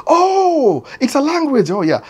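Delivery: high, surprised oh; sister-in-law learning Swahili is a language, mock surprised; mock surprise. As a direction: squeal like a sudden realization. Sister-in-law's surprised reaction.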